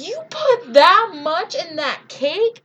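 high, loud, drawn-out incredulous question; retold outraged astonishment; shocked disbelief. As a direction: lean slowly on each word, aghast. Acting out shock at the amount.

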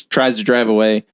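ordinary talk

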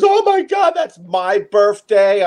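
high-pitched mock squeal, then slowing down; imitated delighted surprise. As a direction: imitate a lady thrilled by a coincidence.

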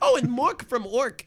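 mock awe. Mocking his roommate's sitcom taste with slow, high, loud awe. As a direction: gush over it in exaggerated awe.

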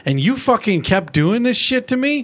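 hammed-up scolding; angry pony rant at Spike; mock angry Twilight. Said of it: "She's like".

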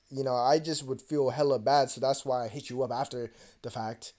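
ordinary delivery

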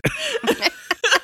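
squealing high laugh. Real, not acted. Laughing at own retelling.

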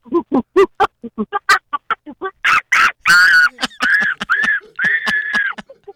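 high, screeching monkey calls; monkey noises. Pretend. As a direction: squeal like a monkey on request. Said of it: "do some monkey noises for us".

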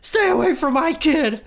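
very high mock-female drama voice; hammed-up heroine defending her child; Meredith's threatening mother. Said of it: "Meredith says".

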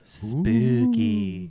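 high ghostly spooky voice. Pretend.

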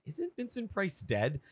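Lisa voice. Retold or imitated. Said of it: "she's looking up at Marge like".

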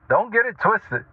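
normal talking voice

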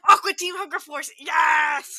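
loud, high excited yell; excited shout. Real, not acted. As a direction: shout it out in delight. Excited recognition.

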